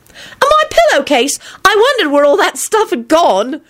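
very high, excited disbelief; delighted astonishment. A narrator's reading. Elizabeth discovering he took her pillowcase, amazed. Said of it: "started giggling delightedly".

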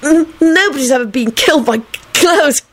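high, stammering disgust. A narrator's reading. Melvin dismissing Clive's fears, disgusted. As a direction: stumble on the first sounds, disgusted. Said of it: "Melvin said disgustedly".

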